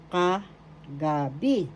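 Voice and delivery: "Kagabi" slowly, as "ka", pause, "gabi"; slow, split syllables. Shown as a demonstration. Teacher repeating kagabi for learners, slow and split. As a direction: pull "Kagabi" apart, lingering on each piece.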